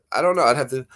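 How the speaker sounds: usual speaking voice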